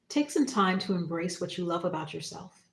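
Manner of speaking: ordinary delivery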